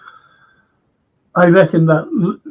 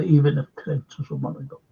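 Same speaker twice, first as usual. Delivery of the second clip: usual speaking voice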